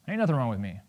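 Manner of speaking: low, quiet brush-off; understated, low-voiced denial; dismissive denial. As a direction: wave the question away softly. First denial to the therapist.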